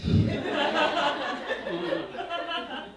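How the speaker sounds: high, giggly chuckle; giggle at own joke. Pretend. Comic giggling at his own notebook joke. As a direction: giggle like you find yourself hilarious.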